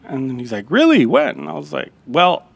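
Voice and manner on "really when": imitating a genuinely surprised chef. Chef hears the cook has visited his restaurant, surprised and higher. Chef's surprised question retold.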